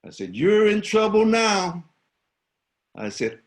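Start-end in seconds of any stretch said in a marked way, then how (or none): 0.4-1.8 high, loud threat; yelling. Retold or imitated. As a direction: shout the warning at the bully. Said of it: "And so I yelled at him".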